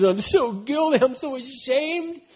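slow, whiny mock wallowing; a touch of mock whining; mock self-pity. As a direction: act out the self-pitying sinner, slow. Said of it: "the devil's goal is a lie to get you to wallow in it".

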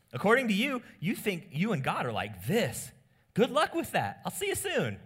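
mock them for thinking they're well; Jesus needling the self-righteous, ending in a laugh; Jesus rebuking. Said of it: "He says".

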